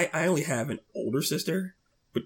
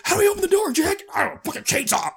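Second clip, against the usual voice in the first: mock panic. Mocking agents at the door.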